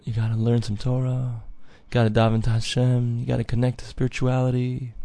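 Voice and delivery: soft, gentle inner voice; barely above a murmur, like a conscience; quiet, earnest voice of the good inclination; very quietly. Acted. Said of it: "Yetzer Tov says very quietly".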